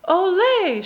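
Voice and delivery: loud joyful shout. Acted. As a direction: shout a greeting to a friend. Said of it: "shouted his amigos".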